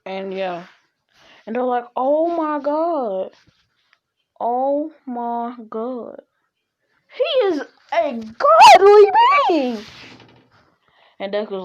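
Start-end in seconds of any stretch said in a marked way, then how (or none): 2.0-6.2 slow, drawn-out acted amazement; stunned onlooker, dragging the words out; awestruck. Onlookers react in awe. 7.1-9.8 loud, high-pitched, stretched-out proclamation; hammed-up worship, building to a yell; awestruck shout. Onlookers proclaim their awe.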